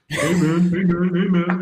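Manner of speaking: high, eager bro voice imitating a fan; mock fan greeting. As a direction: play the overeager Seattle fan calling out. Mocking Seattle fans greeting him.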